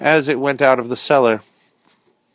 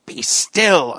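begging; 4 semitones higher than usual. Acted. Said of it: "I begged".

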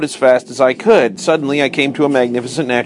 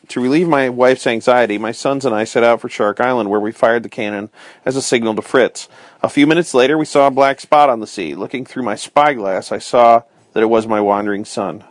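ordinary delivery, nothing marked

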